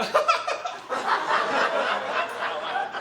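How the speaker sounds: high, loud involuntary cackle; shrieking laugh that breaks the silence; burst of laughter. Real, not acted. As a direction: crack up out loud. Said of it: "That's when I went".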